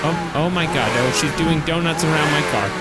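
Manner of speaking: ordinary talk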